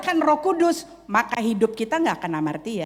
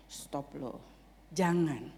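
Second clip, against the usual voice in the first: low, soft caution; hushed, low word of warning; quiet warning; 10 semitones lower, 10 dB quieter, much slower than usual. Acted. One believer reminding another to stop, quiet warning. Voices a gentle mutual warning.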